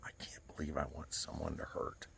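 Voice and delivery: whispered, barely voiced; hushed retold whisper through tears. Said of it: "and I go".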